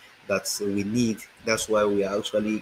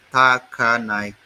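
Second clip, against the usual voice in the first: Takanai drawn out slowly and louder; slow sounding-out; 7 dB louder, slower than usual. Shown as a demonstration. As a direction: sound out Takanai syllable by syllable, firmly. Sounding out the town name.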